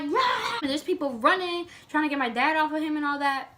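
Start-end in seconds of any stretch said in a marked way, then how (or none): 0.0-0.6 high yelled run; dad warning the boat driver, yelled high; shouted warning. Retold or imitated. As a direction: call it out sharply. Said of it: "He's like".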